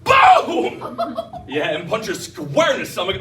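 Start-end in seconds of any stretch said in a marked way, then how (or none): 0.0-1.2 very high explosive yell; hammed-up high-pitched impact; punch sound effect. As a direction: act out a blow with a high shout. Acts out the brother's punch.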